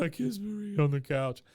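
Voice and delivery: high whiny sob, imitated; mock crying. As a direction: blubber it like a heartbroken boy. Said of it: "Bobby is just crying and sobbing".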